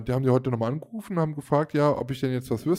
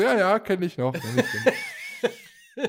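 laughing through it; 6 semitones higher, slower than usual. Retold or imitated. Laughing while retelling her reply.

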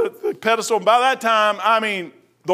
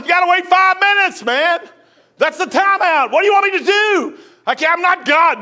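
exasperated retort; 9 semitones higher, 6 dB louder than usual. Retold or imitated. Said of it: "I said".

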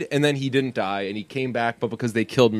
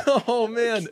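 laughing; 11 semitones higher, 3 dB quieter than usual. Real, not acted. Laughing at own impression.

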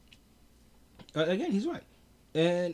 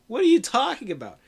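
louder and higher, laughing disbelief; incredulous outburst; 8 semitones higher, 8 dB louder than usual. Retold or imitated. Gamer voicing Russia, outraged at NATO: loud disbelief. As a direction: throw it back in disbelief. Mock indignant retort continues.

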